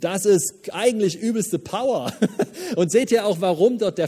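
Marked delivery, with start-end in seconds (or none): 2.2-2.7 short high giggle; high laugh. Real, not acted. Preacher laughing after calling meekness power, high giggle. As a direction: laugh with delight.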